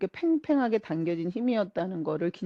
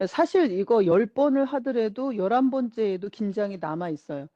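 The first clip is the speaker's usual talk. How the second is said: usual speaking voice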